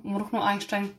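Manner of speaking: ordinary delivery, nothing marked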